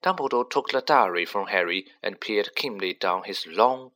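ordinary delivery, nothing marked

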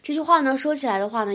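normal talking voice

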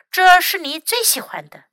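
ordinary delivery, nothing marked

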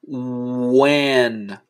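"when" drawn out from a long oo; slow pronunciation demo. Shown as a demonstration. Teacher giving a similar example to which, slow stretched demonstration. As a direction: hold the oo opening of "when" and linger on the n. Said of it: "类似的".

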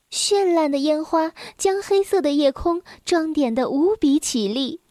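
usual speaking voice

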